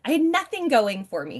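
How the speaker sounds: ordinary delivery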